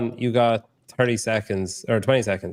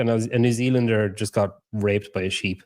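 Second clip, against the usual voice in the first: normal talking voice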